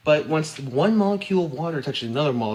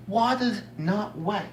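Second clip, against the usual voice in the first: slow, higher-pitched mock quote; mocking imitation; 6 semitones higher, 3 dB quieter, slower than usual. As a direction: parrot the claim in a milder silly voice. Re-quoting the opposing claim.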